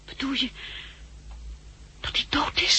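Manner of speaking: fearful breathy whisper; barely voiced, dreading the answer. Daughter asks if her father is dead, in a whisper.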